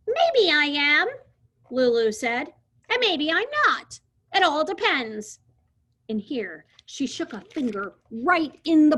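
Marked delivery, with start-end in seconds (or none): 0.1-1.1 put-on bossy girl. Lulu bossy back. 2.9-5.4 put-on bossy girl. Lulu bossy.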